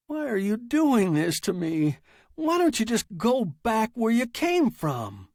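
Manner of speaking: high-pitched pleading protest; exasperated writer. Acted. Writer begging the machine to go away, high exasperation.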